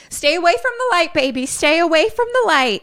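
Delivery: high, urgent mom voice; mother urging. Retold or imitated. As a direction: warn the child with growing worry. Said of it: "Mom says".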